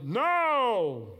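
a loud, high group shout; team yelling no. Retold or imitated. Players answering their coach, yelling. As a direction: bellow it back as the whole team. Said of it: "The team responded".

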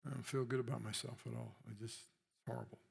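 sinking to a near-whisper, defeated; dejected self-talk. Acted. Elijah voiced by the preacher, sinking into dejected misery. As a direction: let the words collapse into misery. Voicing low self-esteem.

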